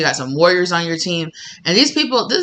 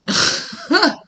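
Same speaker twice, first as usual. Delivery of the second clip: a high amused giggle; 13 semitones higher than usual. Real, not acted. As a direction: giggle at your own sass. Laugh after sassy line.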